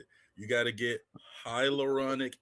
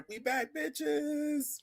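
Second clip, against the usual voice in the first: hype; 3 dB quieter, much slower than usual. Acted. Hype close of intro.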